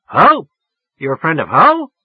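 incredulous, loud and high; near yelling. A narrator's reading. Woman challenging the caller's story, nearly yelling. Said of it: "She was damn near yelling at me".

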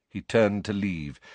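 usual speaking voice

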